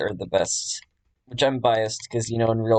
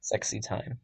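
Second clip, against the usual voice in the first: quiet joking; 10 dB quieter than usual. Real, not acted. Joking while drawing tail.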